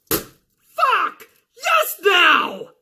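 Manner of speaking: high, snapped answer; frustrated Carmella. Retold or imitated. Carmella answers the referee, high and frustrated. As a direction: bite it out in frustration. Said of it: "Mike Chioda's like".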